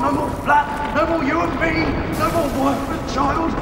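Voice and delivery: normal talking voice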